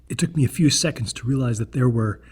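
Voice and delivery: plain reading voice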